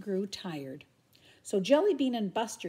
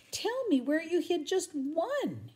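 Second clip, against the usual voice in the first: high, slow pleading; begging; 9 semitones higher, slower than usual. Acted. Buster begging Jellybean for a hint, pleading. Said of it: "he begged Jellybean".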